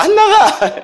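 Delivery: very high mock demon squeal; suddenly changed voice. Speaker imagines his own voice changing during prayer, high mock-possessed cry. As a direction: leap up into a silly possessed squeal. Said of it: "갑자기 제 목소리가 바뀔까?".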